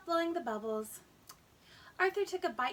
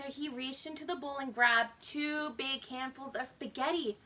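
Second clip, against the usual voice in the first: ordinary delivery, nothing marked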